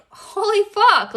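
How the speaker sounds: high, drawn-out astonishment; retold shock. Recalling the inner child's outburst, stunned.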